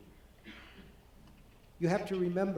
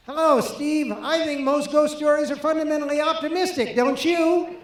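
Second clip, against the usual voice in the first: higher, louder mock Kubrick voice; Kubrick quoted; 10 semitones higher, 9 dB louder than usual. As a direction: play Kubrick up high and loud on the phone. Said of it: "And he says".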